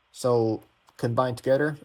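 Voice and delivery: normal talking voice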